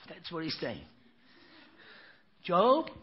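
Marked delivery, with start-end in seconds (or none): 2.4-2.9 calling out. Retold or imitated. God calls Job by name.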